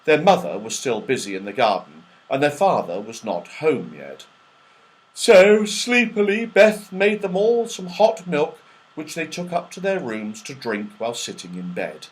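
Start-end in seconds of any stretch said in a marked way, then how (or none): none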